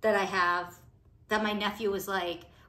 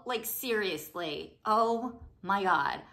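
ordinary talk, nothing marked

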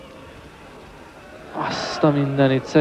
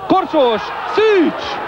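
excited; 14 semitones higher, much slower than usual. Real, not acted. Shot and save, shouted names.

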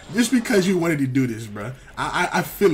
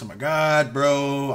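usual speaking voice

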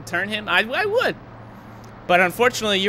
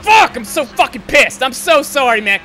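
real rage, much higher and louder; furious yell; 9 semitones higher, 9 dB louder than usual. Real, not acted. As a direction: scream it at the screen. Raging at a wreck in race.

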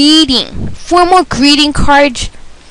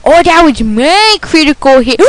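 ordinary delivery

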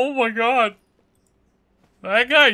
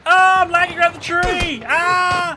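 panicked yelling; 7 semitones higher, 4 dB louder, much slower than usual. Real, not acted. Being chased by car.

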